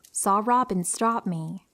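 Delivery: ordinary delivery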